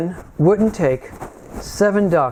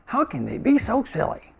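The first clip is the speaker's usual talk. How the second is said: high-pitched, softer mock incredulity; mock disbelief; 7 semitones higher, 4 dB quieter than usual. Congregation imagining reading the scriptures, mock disbelief. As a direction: marvel lightly at someone else's silliness. Acts out readers' scorn.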